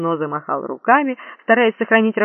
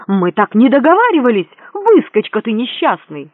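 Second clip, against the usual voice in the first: loud, raised, indignant protest; angry cry; 4 semitones higher, 6 dB louder than usual. A narrator's reading. Duke to the cheating prince, angry shouting. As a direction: hurl insults at the upstart. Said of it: "злобный крик герцога Рудольфо".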